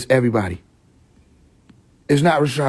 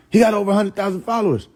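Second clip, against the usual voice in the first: high, fast, lightly voiced woman; mocking a woman; 7 semitones higher, faster than usual. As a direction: parrot her quickly and dismissively. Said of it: "she was like".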